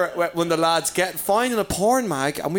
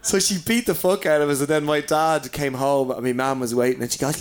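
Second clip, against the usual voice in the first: usual speaking voice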